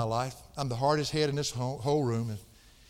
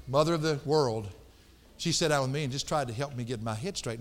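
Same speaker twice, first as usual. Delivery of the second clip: usual speaking voice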